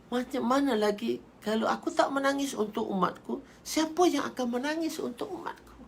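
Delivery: high, aching questioning; acted tearful lament. Prophet asks who else will weep for them, high lament. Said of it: "Nabi menangis".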